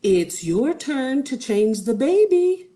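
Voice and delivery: a hint of a nagging wife; lightly voiced wife. A narrator's reading. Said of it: "said the wizard's wife".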